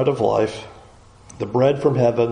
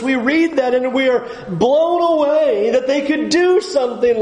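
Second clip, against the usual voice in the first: preaching at full force; 9 semitones higher than usual. Acted. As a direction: build the sermon to a ringing peak. Preaching climax on Israel's failure.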